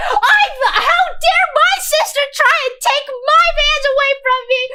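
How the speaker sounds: high, loud shrieking jealous rant; jealous girlfriend screech. Retold or imitated. Retelling a jealous sister bursting in, shrieking high. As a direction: shriek at the rival sister like a betrayed lover. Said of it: "And Susie goes".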